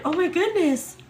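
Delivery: high, loud shocked exclamation; stunned gasp. Retold or imitated. Seeing two lines on the test, high shocked exclaim. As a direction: blurt it out in disbelief.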